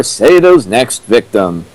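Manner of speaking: ordinary talk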